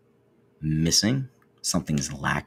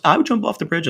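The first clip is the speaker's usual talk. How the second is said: much higher and louder, cheeky sing-song comeback; smart ass response; 13 semitones higher, 6 dB louder, faster than usual. Retold or imitated. A kid answering the bridge line with a smart-ass comeback. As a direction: toss it back like a cocky teen. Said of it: "you get the smart ass response".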